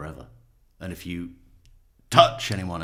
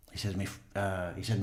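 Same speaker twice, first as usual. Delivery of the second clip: ordinary delivery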